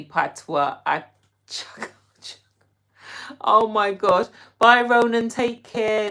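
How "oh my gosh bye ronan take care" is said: loud goodbye. Real, not acted. Calling goodbye to viewer.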